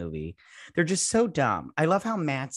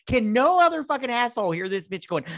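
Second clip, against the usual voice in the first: high incredulous outburst; high exasperated rant at the movie; 9 semitones higher than usual. Retold or imitated. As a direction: throw it up high in disbelief. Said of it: "I'm like".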